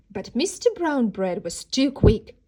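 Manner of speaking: plain reading voice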